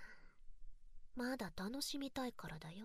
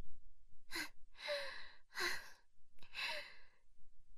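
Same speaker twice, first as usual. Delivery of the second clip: whispered giggle; 14 semitones higher than usual. Acted. Teasing giggle in ear.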